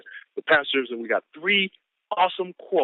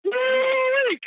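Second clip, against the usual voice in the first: hype whoop. Real, not acted. Speaker whooping after reading the quote. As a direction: let out a big excited whoop.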